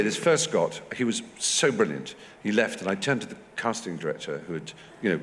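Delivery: ordinary talk, nothing marked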